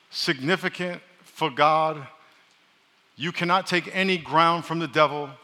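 usual speaking voice

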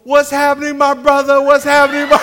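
loud, high hearty greeting; loud buddy greeting. Retold or imitated. Buddies walk in calling to the preacher loud and hearty. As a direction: boom it out like old buddies. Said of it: "They go".

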